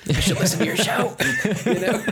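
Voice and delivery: giggling as you confess; laughing. Real, not acted. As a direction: crack up while talking. Laughing at own joke.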